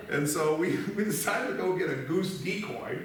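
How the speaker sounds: ordinary delivery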